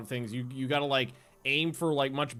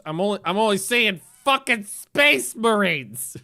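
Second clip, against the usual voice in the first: loud, higher-pitched grumble; exasperated complaint; 5 semitones higher, 7 dB louder than usual. Real, not acted. Complaining while wanting orcs instead.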